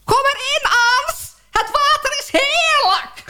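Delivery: high, slow call across the water; distant high cheerful call; calling from afar. Retold or imitated. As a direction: shout cheerfully from far out at sea. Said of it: "hoor ik iemand roepen".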